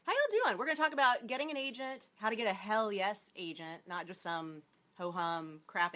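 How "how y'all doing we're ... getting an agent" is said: high, loud, bright hello; excited greeting. Real, not acted. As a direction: greet the crowd with a burst. Bright show opener.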